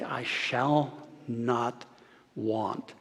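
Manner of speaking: low, hushed, very slow declaration; calm, not pleading. Acted. Preacher voicing David's realization, calm and assured. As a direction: let it settle softly, word by word. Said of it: "he wasn't pleading, he wasn't begging".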